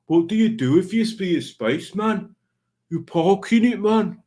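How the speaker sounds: high put-on regional accent; Tommy Dapper. Retelling Tommy Dapper's spaceman joke, high put-on accent. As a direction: pitch it up and broaden the vowels into an accent. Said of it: "my nephew speaks like Tommy Dapper".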